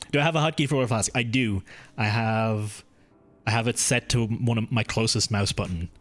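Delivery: ordinary talk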